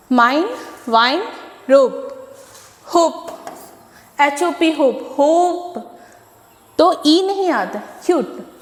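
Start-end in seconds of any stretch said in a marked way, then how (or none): 4.7-6.0 slow होप with the vowel drawn out long; stretched vowel. Shown as a demonstration. Teacher drags out होप to show the long vowel. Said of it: "थोड़ा long stretch करेंगे".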